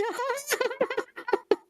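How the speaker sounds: squeaky high-pitched giggle. Acted. King laughs at own joke.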